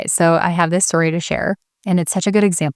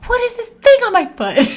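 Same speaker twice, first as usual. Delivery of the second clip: yelling. Retold or imitated. Mom yelling at the slime on her foot, panicked. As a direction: shriek it out in disgust. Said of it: "I'm yelling at this point".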